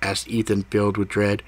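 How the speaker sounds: usual speaking voice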